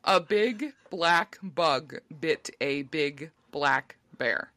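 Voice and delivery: very slow, word by word; slow deliberate enunciation; very slow careful. Real, not acted. Slow careful tongue twister try.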